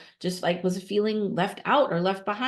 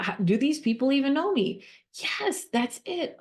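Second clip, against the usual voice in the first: upset donor; 7 semitones higher than usual. Acted. Role-play donor feeling unseen.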